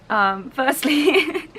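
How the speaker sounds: loud, slow, high words breaking into laughter; real laughter in the voice; speaking through laughter. Real, not acted. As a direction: try to start while still laughing. Recovering from laughing at clip.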